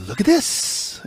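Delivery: put-on high breathy childlike whisper; whispered kid voice. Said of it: "something like a kid would say".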